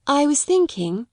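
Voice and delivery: proud and courteous, chin up; grandly and politely. Acted. Sarah to Miss Minchin, grand and polite. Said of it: "she answered grandly and politely".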